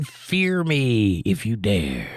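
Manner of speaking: drawn-out, slow hammed-up threat; villain's slow dare with a low chuckle; mock menacing dog. Host voicing a yelling dog, hammed-up menace. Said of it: "The dog yelling".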